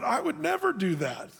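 high-pitched, self-righteous protest; "never" pitched high in mock outrage; indignant denial. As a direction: act shocked that anyone could think it. Hazael's thought, acted out.

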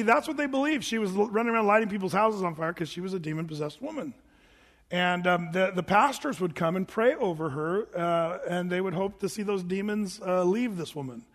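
ordinary delivery

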